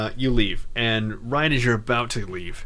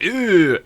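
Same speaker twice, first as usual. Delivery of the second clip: disgusted groan; 8 semitones higher, 8 dB louder than usual. Retold or imitated. Kreia's disgusted reaction retold.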